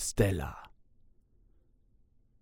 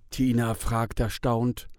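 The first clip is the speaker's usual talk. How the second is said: ordinary delivery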